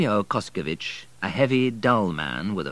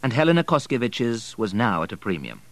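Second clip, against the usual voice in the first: plain reading voice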